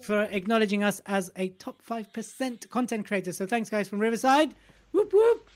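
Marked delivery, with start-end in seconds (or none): 4.9-5.5 high, loud whoop; cheer. Real, not acted. Host cheering for Riverside, high and loud. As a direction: celebrate like a fan.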